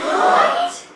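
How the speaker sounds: squeaky high shocked yelp; shocked what. Acted. Prophet reacting to the kidnapping, shocked yelp. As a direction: gasp it out in disbelief.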